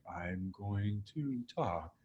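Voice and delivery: very slow and soft, dragging each word; overly slow speech. Shown as a demonstration. Mocking speakers who pause too much, very slow. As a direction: drag it out to mock long pauses. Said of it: "people that take too many breaths".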